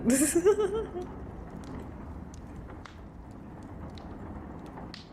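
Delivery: high, long trailing giggle; high laugh. Real, not acted. Streamer amused at their own remark, high laughing.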